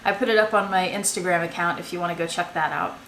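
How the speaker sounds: normal talking voice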